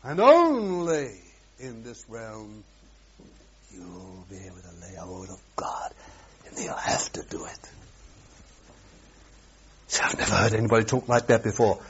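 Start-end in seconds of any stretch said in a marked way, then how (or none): 1.6-7.6 hushed unvoiced whisper. Real, not acted. Preacher whispering the promise to the congregation. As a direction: drop to a secret whisper.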